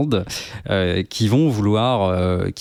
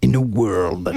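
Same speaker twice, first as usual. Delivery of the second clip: movie trailer voice. Pretend. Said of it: "genre Michael Bay".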